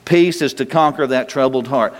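ordinary talk, nothing marked

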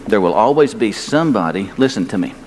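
ordinary delivery

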